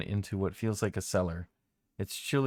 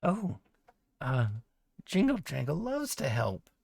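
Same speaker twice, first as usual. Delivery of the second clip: goblin voice; 6 semitones higher than usual. Acted.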